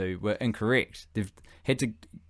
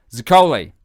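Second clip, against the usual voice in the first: loud name call; 3 semitones higher, 10 dB louder than usual. Acted. Somali turning to Zaccoli with a plan, calling out. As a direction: get the person's attention with a loud call.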